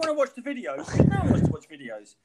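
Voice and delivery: high, loud, hammed-up begging; high excited plea. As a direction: beg the listeners loudly, over the top. Mock pleading for money.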